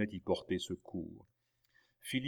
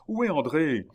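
high, loud demanding question; urgent shout; 7 semitones higher, 8 dB louder than usual. A narrator's reading. Young man demanding his sister, shouting urgently. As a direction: shout the question as you approach. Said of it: "cria le jeune homme".

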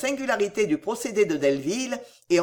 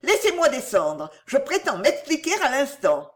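higher-pitched, alarmed demand; frightened; 7 semitones higher than usual. A narrator's reading. Cécile demanding to get down and explain, frightened. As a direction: insist urgently in a raised pitch. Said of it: "s'écria Cécile effrayée".

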